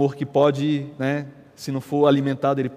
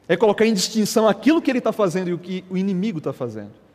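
ordinary talk, nothing marked

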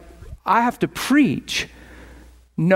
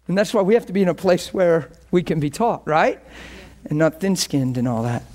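ordinary talk

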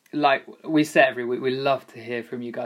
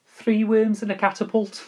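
higher-pitched Liverpool accent; demonstrating a Liverpool accent, pitched up; 9 semitones higher, 4 dB louder than usual. Shown as a demonstration. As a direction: put on a Scouse accent for a trigger phrase. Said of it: "like, a Liverpool accent".

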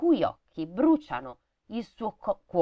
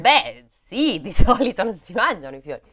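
louder, higher mock voice of herself; mock puzzled remark; 4 semitones higher, 7 dB louder than usual. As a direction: voice your own confused thought, louder. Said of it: "e dicevo". (finta perplessità, più forte)

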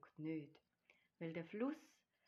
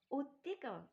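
high, happy call to a friend; delighted exclamation; 8 semitones higher, 4 dB louder than usual. A narrator's reading. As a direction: exclaim with contentment. Bear sighs happily to Tiger. (freudig und hoch ausgerufen)

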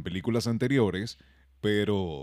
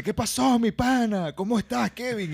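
much higher cheerful hey-buddy greeting; friendly greeting; 13 semitones higher, 4 dB louder than usual. Retold or imitated. Protester greeting Kevin, voiced high and cheerful. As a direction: greet him like an excited friend. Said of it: "viene alguien y me dice".

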